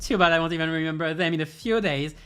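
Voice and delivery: high, loud, sneering voice ending in a cackle; gloating villain. Acted. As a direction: relish their doom and laugh. Villain gloating, then laughing.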